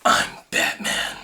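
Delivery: low gravelly unvoiced growl; whispered Batman growl. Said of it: "Batman impression".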